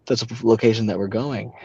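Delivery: usual speaking voice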